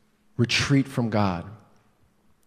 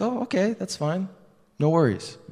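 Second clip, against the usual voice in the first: easygoing youth pastor; 7 semitones higher, 4 dB quieter than usual. Retold or imitated. Youth pastor voiced in story.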